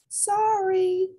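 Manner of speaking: high alien voice with a burp; burped apology. Acted. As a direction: burp out a small sorry. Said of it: "he burped".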